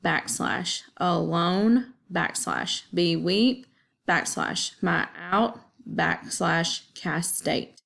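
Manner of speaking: slow, pausing at each backslash; slow, deliberate split into feet; reading the foot breaks. Shown as a demonstration. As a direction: mark off every two syllables slowly. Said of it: "put a backslash after every second syllable".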